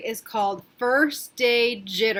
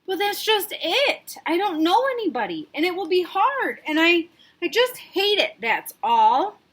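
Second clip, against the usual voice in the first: put-on whiny child; 8 semitones higher, 4 dB louder than usual. Sarah sulking to her dad about knowing nobody. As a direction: sulk it out.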